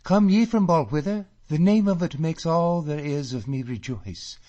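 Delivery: high, loud delighted questions; excited joy. A narrator's reading. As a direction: pour out her joy at home news. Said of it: "she cries".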